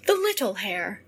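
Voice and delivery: plain reading voice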